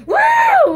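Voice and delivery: very high, loud "woo" mimicking a whistle; sudden high-pitched whistle squeal by voice; imitated whistle. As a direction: shriek like a piercing whistle. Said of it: "with the whistle".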